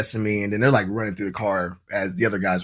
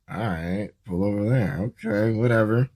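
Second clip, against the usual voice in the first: softer, shrugging repeat of "pull over there"; resigned muttering; 3 semitones lower, 4 dB quieter than usual. Retold or imitated. Driver echoes officer's order, softer and resigned. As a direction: mumble it back like it's no big deal. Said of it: "And I'm like".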